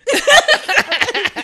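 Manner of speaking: high, loud burst of laughter. Real, not acted. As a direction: crack up at the joke. Laughs at doo-doo joke.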